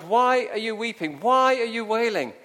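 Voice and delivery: loud, high, emphatic questions; challenging the crowd. Acted. Jesus to the weeping crowd, loudly challenging. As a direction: hit each WHY hard.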